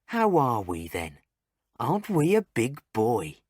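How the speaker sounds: mimicking nurses talking down to a baby. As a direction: coo it at a baby, mocking. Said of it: "they say to babies".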